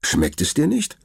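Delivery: put-on high, eager little boy; boy's voice. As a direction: lift the voice like a curious child. Narrator voices Leon. (hohe Jungenstimme)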